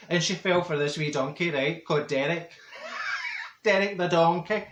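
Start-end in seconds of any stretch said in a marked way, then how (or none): 2.7-3.6 a soft, high-pitched giggle. Real, not acted. [name] giggles at Derek the donkey, high and soft.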